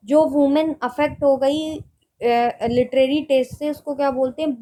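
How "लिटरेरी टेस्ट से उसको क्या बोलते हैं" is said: usual speaking voice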